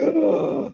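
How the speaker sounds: high mock gasp; drawn, high scandalized gasp; shocked gasp. Acted shocked reaction to insult.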